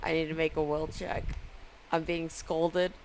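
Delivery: very quiet, low muttering; hushed, deep aside; low scolded aside. Pretend. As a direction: mutter it under your breath, low and small. Player aside while being scolded.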